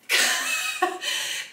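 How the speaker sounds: high squealing laugh; squealing sung laugh. Real, not acted. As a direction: break into a squeaky, singsong giggle. Giggling at the oversized skirt.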